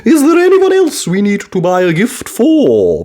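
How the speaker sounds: lightly voiced grandmother, wondering; a hint of a curious old lady; Granny Pig voice. A narrator's reading.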